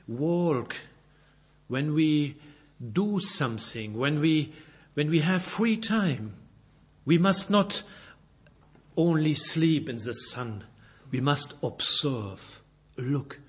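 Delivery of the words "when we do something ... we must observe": ordinary talk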